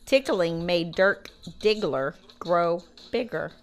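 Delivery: slow, every word overshaped; slow exaggerated mouthing. Shown as a demonstration. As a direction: mouth it slowly for lip reading. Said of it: "Say it again".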